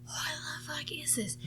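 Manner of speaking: whispered, stunned reaction; retold whispered dismay; hushed disbelief. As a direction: whisper it as if stunned by what just happened. Stunned reaction to van scraping garage.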